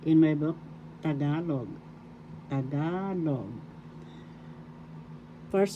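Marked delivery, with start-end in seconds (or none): 1.0-1.7 GA stressed in "Tagalog"; stress on second syllable. Shown as a demonstration. Teacher showing learners the pronunciation, GA stressed in "Tagalog". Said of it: "how do you pronounce Tagalog". 2.5-3.5 GA drawn out in "Tagalog"; stress on second syllable. Shown as a demonstration. Teacher repeating the word for learners, long stressed GA in "Tagalog". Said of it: "accent is in the second syllable".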